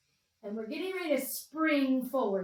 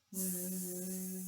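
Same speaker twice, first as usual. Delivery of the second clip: a steady buzz through the teeth like a bee; buzzing bee zzz; 3 semitones lower, 5 dB quieter than usual. Shown as a demonstration. Teacher buzzing like a bumblebee for the class. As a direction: buzz it out low and even. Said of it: "So let's try going zzz".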